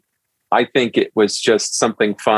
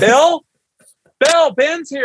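calling out; slower than usual. Retold or imitated. Mom calls husband under the sink, high call. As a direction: sing out his name to the kitchen.

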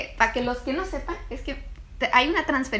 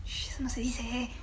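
slow hushing whisper; 8 dB quieter than usual. Acted. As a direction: shush him in a whisper. Hushing him as a joke.

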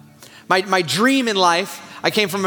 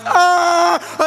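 high mock scream of panic; freaked-out yell; 10 semitones higher, 4 dB louder than usual. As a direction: act out a startled, high cry. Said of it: "freaking me out".

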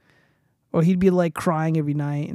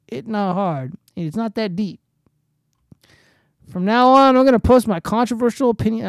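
loud, higher, emphatic read-out; reading a tweet aloud; 5 semitones higher, 8 dB louder than usual. Acted. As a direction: proclaim it like a public rule.

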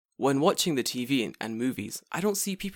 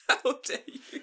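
breathless half-laughed whisper; laughing mock indignation; mock outrage; 12 semitones higher, 4 dB quieter, slower than usual. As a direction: wheeze it out in fake offense.

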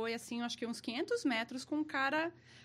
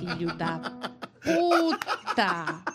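laughing curse; 3 semitones lower, 5 dB louder, much slower than usual. Retold or imitated. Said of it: "só falei".

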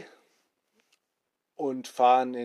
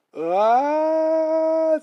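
high long scream, much higher and louder; thrilled scream; 8 dB louder than usual. Acted. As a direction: scream as the boat loops. Boat shoots into the loop. (langer hoher Schrei)

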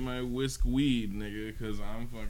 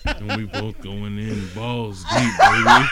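laughing shout; 11 dB louder than usual. Real, not acted. Yelling crude joke laughing.

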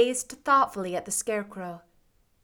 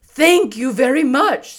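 loud, higher, grateful straw man; husky scarecrow; 4 semitones higher, 12 dB louder than usual. A narrator's reading. Scarecrow thanking the girl who freed him, husky. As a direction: thank her heartily as a scarecrow. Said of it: "in a rather husky voice".

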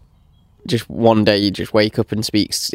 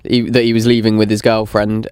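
normal talking voice

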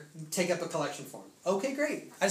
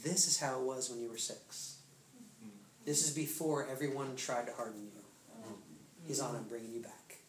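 hushed, low, intimate voice of God; low, quiet divine reassurance; God speaking; 6 semitones lower, 13 dB quieter than usual. Retold or imitated. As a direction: speak very softly, as God to a child. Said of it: "And then God says".

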